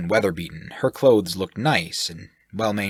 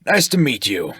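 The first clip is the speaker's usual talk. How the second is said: loud, higher, cheerful; happy greeting; 5 semitones higher, 5 dB louder than usual. Acted. Shirk greets the sailor happily and loudly. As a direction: beam it out jovially. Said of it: "Shirk responded happily".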